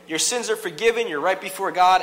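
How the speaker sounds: cheerful priest. Retold or imitated. The priest declares sins forgiven, loud and breezy. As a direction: bless him high and bright, like a salesman. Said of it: "the priest would say".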